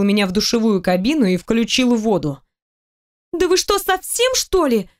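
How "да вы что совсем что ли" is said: high, panicked outcry; horrified yell. A narrator's reading. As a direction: cry out in horror. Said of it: "Заорала я от ужаса".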